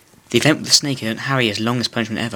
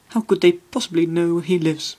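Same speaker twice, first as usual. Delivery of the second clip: higher, trembling frightened question; quivering voice; 7 semitones higher than usual. Acted. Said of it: "in a quivering voice".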